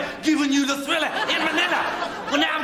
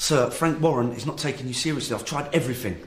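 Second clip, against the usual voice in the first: a much lower character voice; deep put-on voice. To the boss, a much lower mock voice. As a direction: drop the voice right down. Retelling a scene, quoting a character.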